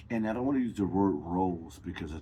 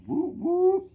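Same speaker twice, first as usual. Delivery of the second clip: excited whoop; 7 dB louder than usual. Real, not acted. Hype call at show opening.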